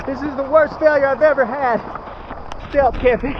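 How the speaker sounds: high, breathless and frantic; flustered in the rain, voice up high. Real, not acted. Drenched in storm, night camp.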